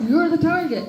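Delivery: high, loud mock alarm; mock loud warning. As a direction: yell it like a joke warning. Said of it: "He's like".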